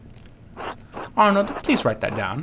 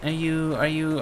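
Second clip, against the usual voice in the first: ordinary delivery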